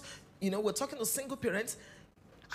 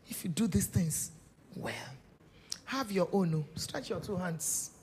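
normal talking voice

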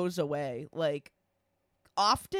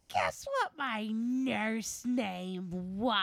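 very slow, drawn-out teasing question; dragged out every word; 2 semitones higher, 4 dB quieter, much slower than usual. Retold or imitated. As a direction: stretch each word like a slow tease. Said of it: "she dragged out every word".

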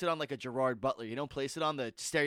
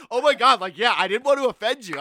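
laughing; 6 semitones higher, 13 dB louder than usual. Retold or imitated. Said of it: "she dies laughing".

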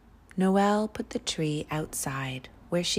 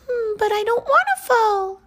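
very high, loud, fast little protest; squeaky tiny character digging in against falling; little snowflake voice; 11 dB louder, faster than usual. A narrator's reading. Snowflake to the cloud as she starts falling, squeaky protest. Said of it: "said the snowflake".

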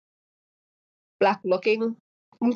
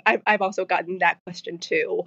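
ordinary talk, nothing marked

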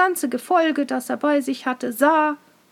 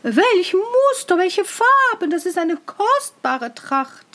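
gushing admiration; 6 semitones higher, 3 dB louder than usual. Acted. Courtiers gush over invisible cloth.

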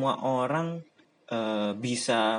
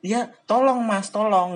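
loud, high pleading; exasperated plea; 9 semitones higher, 7 dB louder than usual. Real, not acted. Pleading with people who assume all Japanese-literature students watch anime, loud. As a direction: beg them to stop. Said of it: "pleading to viewers".

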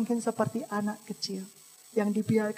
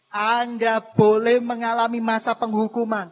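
loud, emphatic pulpit proclamation; thundering preacher; full-force preaching; 17 dB louder than usual. Real, not acted. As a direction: drive each phrase home loudly to the congregation.

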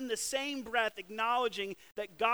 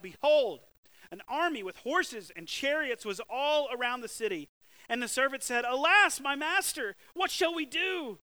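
higher, louder, panicked cry; alarmed servant; 6 semitones higher, 8 dB louder than usual. Acted. Servant to his master, seeing the army, panicked and loud.

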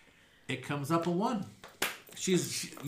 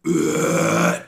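groan; 13 dB louder than usual. Acted. Groan from the floor beneath.

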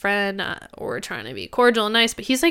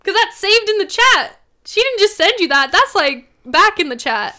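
outraged; 8 semitones higher, 4 dB louder than usual. Retold or imitated. Confronting boyfriend about naked photo.

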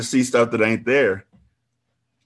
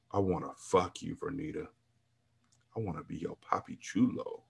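low, hushed seducer's voice; seductive groan; 4 semitones lower, 11 dB quieter than usual. A narrator's reading. Trainer seducing the narrator in the locker room, low groan. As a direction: murmur it low like a lover. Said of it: "he seductively groaned".

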